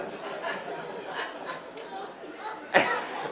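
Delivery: airy burst of laughter; a real high laugh; breathy laugh. Real, not acted. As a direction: laugh it off, helpless. Laughing over the struggling mom.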